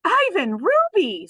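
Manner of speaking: delighted call. Acted. Snickers greeting old friends, delighted call. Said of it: "Snickers calls in a delighted voice".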